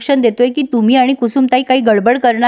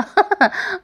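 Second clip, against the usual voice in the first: short high laugh; 6 semitones higher, 5 dB quieter than usual. Real, not acted. Laugh after retelling Arjun's puzzlement.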